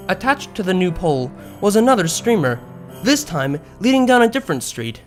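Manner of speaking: usual speaking voice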